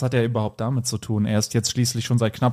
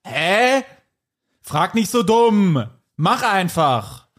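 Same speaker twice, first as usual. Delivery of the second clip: high, drawn-out impatient retort; exasperated snapping; 5 semitones higher, much slower than usual. A narrator's reading. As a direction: order them about, stretching each word. Exasperated exchange in read story.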